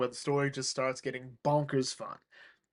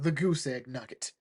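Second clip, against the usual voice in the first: ordinary talk, nothing marked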